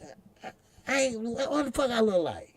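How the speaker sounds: high mocking young son, fast and indignant; son's voice. Son pushing skinny jeans on his father, high mock voice. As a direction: squeak it out like a teenage son. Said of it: "And, and".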